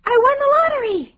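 very high, fast, loud squeal of joy; ecstatic winner. Acted. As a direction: blurt out incredible good news. Acting a lottery winner.

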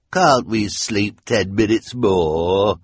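slow, whining plea; whiny henchman. Acted. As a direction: whine like a lazy henchman. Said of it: "the whiny voice of the hook-handed man".

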